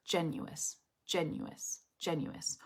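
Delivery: soft, spaced repeats of "genuous"; word-part drill. Shown as a demonstration. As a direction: repeat it quietly to yourself, fading. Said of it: "you should just say".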